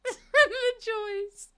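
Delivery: high, loud, squeaky with laughter; laughing. Real, not acted. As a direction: crack up on the words. Laughs at her own misery.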